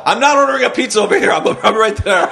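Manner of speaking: high, fast exasperated mock yell; retold yelling. Caller yelling back at wounded man, mock exasperated yell. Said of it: "I remember yelling at him".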